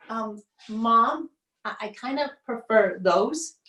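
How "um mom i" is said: ordinary talk, nothing marked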